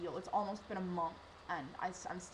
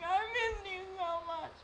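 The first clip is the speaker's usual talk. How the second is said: high, slow, tearful cry; weepy reunion cry; crying with joy; 11 semitones higher, 3 dB louder, slower than usual. Retold or imitated. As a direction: sob it out as you hug him. Jumping into his arms.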